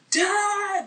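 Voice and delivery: high, loud cry of Dad; calling out for dad. Retold or imitated. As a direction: yell for your missing father. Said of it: "he's like".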